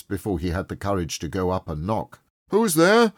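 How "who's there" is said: high, loud call from indoors. A narrator's reading. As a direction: call out to whoever knocked. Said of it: "called a voice from within".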